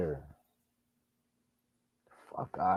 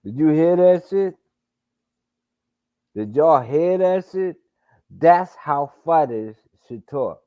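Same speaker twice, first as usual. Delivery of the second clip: loud, high, fired-up calls to chat; rally the viewers, amazed and pumped; hyped exclaiming; 7 semitones higher, 7 dB louder than usual. Real, not acted. Streamer asks chat if they heard, loud and hyped. Hyped reacting to fighter's interview.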